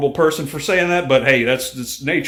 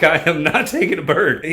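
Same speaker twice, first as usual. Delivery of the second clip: higher, laugh-filled insistence; laughing refusal; 5 semitones higher, 3 dB louder than usual. Retold or imitated. Refusing mother-in-law's plea to keep the bird, laughing.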